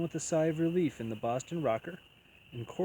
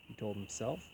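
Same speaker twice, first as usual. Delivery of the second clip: usual speaking voice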